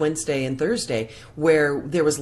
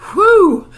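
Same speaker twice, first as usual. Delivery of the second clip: loud, high whew; steadying whoop of breath; big exhale; 13 dB louder than usual. Real, not acted. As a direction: let out a sharp, high "whew". Steadying herself while choked up.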